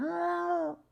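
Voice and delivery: high squeaky meowing; cat meow. Pretend. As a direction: meow back at the cat like a kitten.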